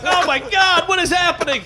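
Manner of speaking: high, loud panicked shout. Retold or imitated. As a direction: freak out at the chaos. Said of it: "I'm like".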